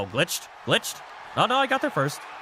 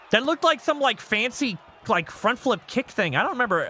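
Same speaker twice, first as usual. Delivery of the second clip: usual speaking voice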